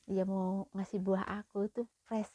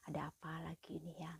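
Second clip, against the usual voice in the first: whispered, softer private thought; whispered worry; 3 semitones lower, 12 dB quieter than usual. Retold or imitated. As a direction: wonder under her breath what now. Wondering to herself at knock. (berbisik cemas)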